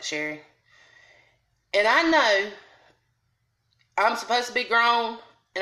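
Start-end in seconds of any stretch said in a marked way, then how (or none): 0.0-0.4 soft, low warning call of her name; a mother quietly reining her daughter in; mother softly warning her daughter to calm down; hushed warning. Retold or imitated. Mother hushing her in waiting room.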